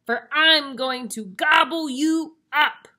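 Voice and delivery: very loud, scary troll. Acted. Troll refuses the little goat, bellowing. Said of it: "said the troll".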